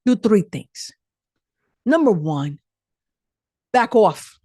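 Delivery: a touch of New York attitude. Real, not acted. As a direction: snap "back off" like a New Yorker. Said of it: "I just went New York there".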